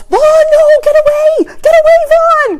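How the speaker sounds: high, loud imitation of a protesting child; shrill mock yell; toddler shouting. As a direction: scream it like a small boy guarding his toys. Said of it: "he shouts".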